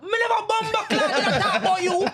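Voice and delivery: high-pitched indignant patois rant; mock outrage, pitched well up; indignant outburst. Jamaican imitates the complainer butting in, high indignant outburst.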